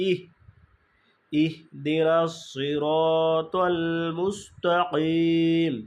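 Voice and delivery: ইহ দিনাস সিরাতাল মুস্তাকিম recited slowly with a stop on ইহ; slow recitation. Shown as a demonstration. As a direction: chant it slowly and clearly. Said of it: "আমাদের এখানে থামতে হবে".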